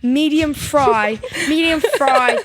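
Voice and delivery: brash British lad; loud British accent. Pretend. Girl pretending to be British at a burger place, loud British accent. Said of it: "really loud British".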